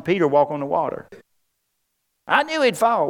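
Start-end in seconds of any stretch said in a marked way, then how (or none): 2.3-3.1 high-pitched carping imitation; mock a doubter in a raised, whiny pitch; mock whine. Disciples in the boat faulting Peter, high mock whine. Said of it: "you want to whine at Peter".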